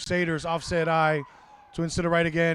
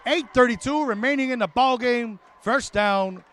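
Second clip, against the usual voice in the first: excited play-by-play; 8 semitones higher, 3 dB louder than usual. Real, not acted. Commentator calling a fourth-down stop to viewers, excited. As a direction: ride the peak of the play, voice pitched way up.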